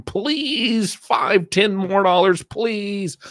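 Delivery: high pleading whine; mock begging. Host acting out begging again, high and pleading. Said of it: "I'll be back begging".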